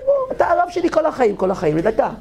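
high, gushing declaration of love; excited shout. Retold or imitated. Student shouting his love to the rabbi, high and excited.

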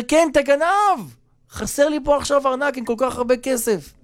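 high-pitched retold accusation; angry yelling. Robbed guest yells back at his host, angry. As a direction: keep the pitch up high, mock outrage. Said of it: "ככה אתה צועק עליי".

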